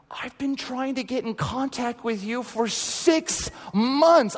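high, drawn-out mock disbelief; playful astonished outburst; retold exasperated amazement. Retold amazed reply to sought-after official.

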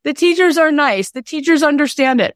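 put-on high, light unbothered kid voice; imitating carefree daughter. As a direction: play the relaxed little sister. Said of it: "She was like".

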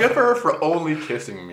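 laughing. Real, not acted. Laughing sarcastic reply.

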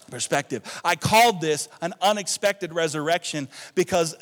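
loud, emphatic sermon delivery; rally the congregation, punching the key words; rousing pulpit voice; full-force preaching. Acted.

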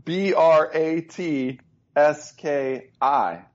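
slow letter by letter; spelling it out. Shown as a demonstration. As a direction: spell each letter slowly. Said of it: "I'm gonna go with".

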